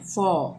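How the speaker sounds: "for" said "faw", r silent; British pronunciation. Shown as a demonstration. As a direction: let "for" end on its open vowel, no r. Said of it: "British लोग इसी तरह से इसको pronounce करते हैं". ("for" का British उच्चारण "फॉ")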